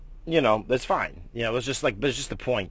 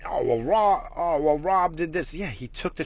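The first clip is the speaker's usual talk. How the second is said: higher, slower mocking clerk voice; mimicking the clerk; 6 semitones higher than usual. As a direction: imitate the clerk's excuse, mocking. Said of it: "He's like".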